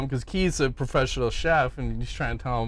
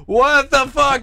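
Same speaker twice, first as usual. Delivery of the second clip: high, loud alarmed outburst; shocked; 11 semitones higher, 8 dB louder, slower than usual. Retold or imitated. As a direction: blurt it wide-eyed. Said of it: "Brian's like".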